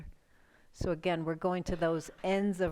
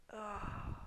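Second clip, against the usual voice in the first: yawn; 3 semitones higher, 8 dB quieter than usual. Real, not acted. Yawning during stretch.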